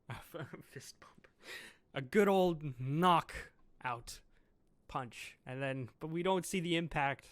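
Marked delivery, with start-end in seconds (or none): 0.0-1.3 a soft unvoiced whisper through a laugh. Real, not acted. The reader laughs to himself about the fist pump, amused whisper. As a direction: whisper it, tickled.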